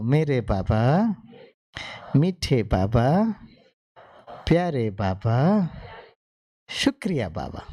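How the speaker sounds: slow, tender calling of "Baba"; full of love. Shown as a demonstration. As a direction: draw each "Baba" out lovingly and slowly. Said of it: "और प्यार भरो". (प्यार भरी धीमी पुकार)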